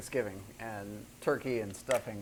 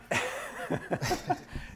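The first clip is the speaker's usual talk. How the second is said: airy high-pitched laugh; high breathy laugh; 4 dB quieter than usual. Real, not acted. Host cracks up at garlic breath answer, high airy laugh.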